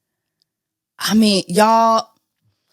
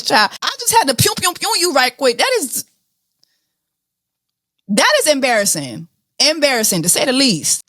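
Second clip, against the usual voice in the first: ordinary delivery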